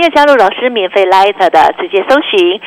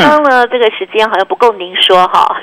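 usual speaking voice

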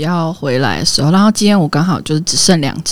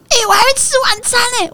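put-on high, fast whiny kid voice; whiny child; faster than usual. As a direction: play the son who suddenly wants dinner. Mother voices son complaining.

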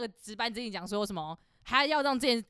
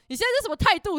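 loud, much higher indignant demand; angry customer; 11 semitones higher, 9 dB louder than usual. Retold or imitated. The customer snaps back at the coworker, loud and indignant. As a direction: bark it like an offended customer. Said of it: "那个男的就说".

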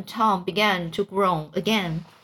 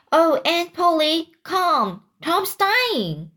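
high, louder acted cry of alarm; alarmed call; 8 semitones higher, 3 dB louder, slower than usual. A narrator's reading. Sid calls Aunt Polly downstairs, alarmed. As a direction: call out urgently, pitch raised high.

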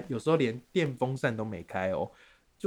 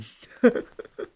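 high burst of laughter; 12 semitones higher, 8 dB louder than usual. Real, not acted. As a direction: squeal of laughter. Laughs at own joke. (尖声大笑)

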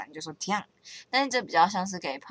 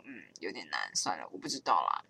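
much lower, softer mumble of defeat; giving up; 12 semitones lower, 6 dB quieter than usual. Retold or imitated. After reading a baffling article, low defeated mumble. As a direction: shrug it off, mumbling low and quiet. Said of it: "我就想说".

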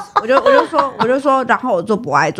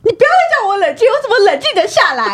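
upset outburst; 11 semitones higher than usual. Retold or imitated. Friend yelling, can't calm down.

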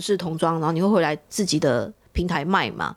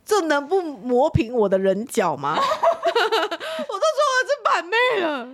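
high, slow, laughing near tears; laughing complaint; 11 semitones higher, slower than usual. Real, not acted. Telling friends about rude callers, high laughing disbelief. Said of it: "laughing while complaining".

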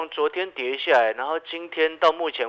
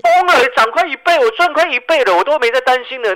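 louder and higher, thrilled about the gains; excited bragging; 10 semitones higher, 7 dB louder than usual. Real, not acted. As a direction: crow about doubling your money.

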